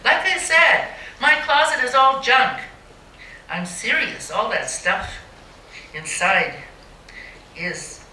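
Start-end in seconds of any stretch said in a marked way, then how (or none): 0.0-2.6 higher, louder insistence; emphatic punchline. Real, not acted. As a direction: make the closet joke land hard.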